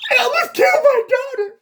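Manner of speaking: high, loud wailing sob-shout, hammed up. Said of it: "the one where he goes".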